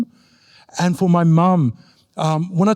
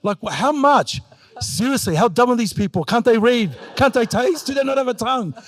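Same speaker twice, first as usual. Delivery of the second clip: high-pitched, incredulous mum; mock-outraged mother, higher voice; exasperated mum voice; 6 semitones higher than usual. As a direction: play mum outraged at cookbook readers. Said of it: "I'm like".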